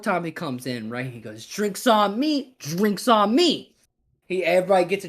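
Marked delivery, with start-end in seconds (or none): 1.5-3.7 high, drawn-out call; stretched, pitched-up toast; repeated barroom announcement. Acted. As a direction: sing out each word slowly to the room.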